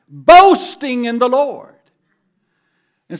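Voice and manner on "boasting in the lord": much louder, higher and slow; loud proclamation. Shown as a demonstration. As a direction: boast it out loud to the Lord. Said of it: "I'll put the emphasis on the word loudly".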